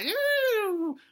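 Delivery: high falsetto dino musing darkly; tiny dinosaur pondering in a very high voice; little dinosaur thinking aloud. Acted. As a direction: squeak out a dramatic soliloquy. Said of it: "now he's thinking and speaking at the same time".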